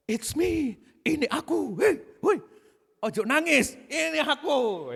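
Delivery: brash pal shouting at a crying friend; rough casual Jesus. Retold or imitated. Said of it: "kenapa kok Tuhan Yesus tidak ngomong". (Yesus versi kasar dan cuek, suara tinggi dan keras)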